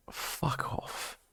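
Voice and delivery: hushed, breathy-whispered dismissal; whispered retort. Retold or imitated. Streamer retelling a reply to a Twitch warning, whispered retort. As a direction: swear under your breath, barely voiced.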